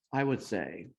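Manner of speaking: ordinary talk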